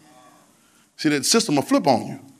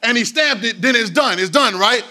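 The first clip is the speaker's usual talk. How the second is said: louder, higher preaching cadence, hammering each phrase; preaching at full force; 7 semitones higher, 4 dB louder than usual. Acted. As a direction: drive it home like a pastor rising in the pulpit.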